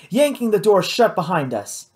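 plain reading voice

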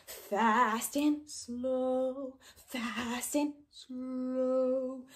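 quick 'fast', stretched 'slow'; fast versus slow. Shown as a demonstration. Teacher contrasting fast and slow by pace. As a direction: drag out the word slow.

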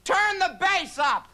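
put-on high, wobbly little-old-lady voice, the last words stretched slow; shrill old neighbour banging on the door; cranky old lady. Old lady next door yelling through the door, high and creaky. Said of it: "a little old lady that lives in the next apartment".

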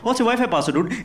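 mock guest. Guest asks for the password.

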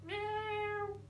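high, soft, drawn-out meow; cat's meow. A narrator's reading. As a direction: mew through a screwed-up face. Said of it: "like this".

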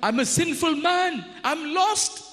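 Isaiah cries out before God; crying out. Acted. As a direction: cry out overwhelmed by holiness. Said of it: "Isaiah cried out".

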